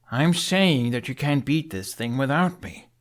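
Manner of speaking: put-on earnest young Viking pleading his case; lightly voiced youthful hero; young Hiccup. A narrator's reading.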